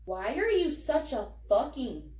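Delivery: usual speaking voice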